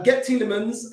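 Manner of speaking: ordinary delivery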